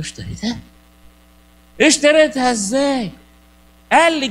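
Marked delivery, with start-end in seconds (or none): 0.0-0.6 soft, rising double-take at اشتريتها; hushed disbelief on اشتريتها; quiet surprised question. Retold or imitated. Priest retells disbelieving question. 1.8-3.1 اشتريتها ازاي said louder and slowly, falling hard; louder, slower, stunned disbelief; loud incredulous question. Retold or imitated. Priest retells disbelieving question.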